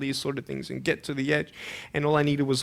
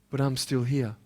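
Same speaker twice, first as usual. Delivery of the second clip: quiet; 4 semitones lower, 5 dB quieter than usual. Retold or imitated. Retold encouragement, quiet end.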